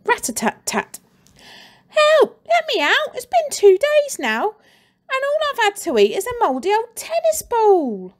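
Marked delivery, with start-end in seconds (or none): 1.9-8.1 put-on much higher, loud boy's plea; crying boy. Trapped boy begging through the cupboard door, high pleading cry. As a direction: beg desperately to be freed. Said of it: "cried the boy".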